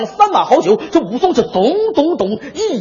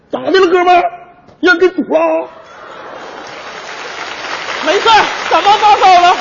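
tavern banter; 5 semitones higher than usual. Acted. Character dialogue before cold ad.

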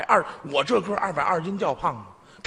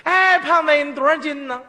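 mock call out; 7 semitones higher, 4 dB louder than usual. Stranger calls out to fat man.

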